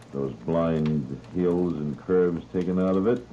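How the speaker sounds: usual speaking voice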